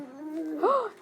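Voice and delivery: high surprised gasp for the class; playful teacher gasp; mock gasp. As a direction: act amazed at the letters. Spotting the I G H trigraph.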